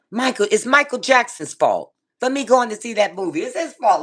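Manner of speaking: high-pitched comic accusation; mock blaming. Real, not acted. Comic blaming of [name].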